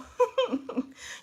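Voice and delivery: squealing laugh; high laugh burst. Real, not acted. Reader cracking up mid-reading, squealing laugh.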